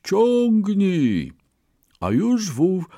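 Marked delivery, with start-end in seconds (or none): 0.0-1.3 high, drawn-out order to pull; called-out command. A narrator's reading. Farmer orders his ox to pull, high command. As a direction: call it out to a working animal.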